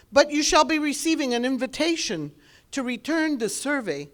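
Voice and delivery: ordinary talk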